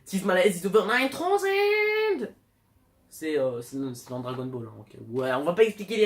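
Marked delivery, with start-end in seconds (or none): 0.0-2.3 high, drawn-out yell in English; over-the-top parody battle cry; hammed-up anime shout. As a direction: build to a long, loud, high scream. Acted Dragon Ball catchphrase.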